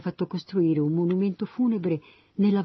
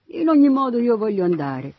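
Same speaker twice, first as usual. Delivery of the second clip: higher, louder insistence; insistent; 7 semitones higher, 6 dB louder than usual. A narrator's reading. Ahimaaz replies to Joab's objection, insistent. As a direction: push back, set on going. Young man insists on going.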